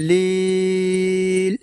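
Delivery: লিল with the ি stretched; long vowel drawn out. Shown as a demonstration. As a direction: draw out লিল slowly. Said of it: "এইভাবে টান দেব". (লিল, লম্বা টান)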